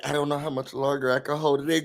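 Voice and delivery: soft, hushed, fast mock confession; mock dramatic whisper. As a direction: play the secret-keeper in a breathy hush. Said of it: "trying to make it seem like".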